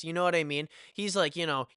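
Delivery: normal talking voice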